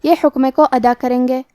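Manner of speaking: normal talking voice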